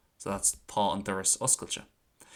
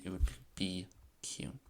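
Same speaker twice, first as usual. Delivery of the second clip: normal talking voice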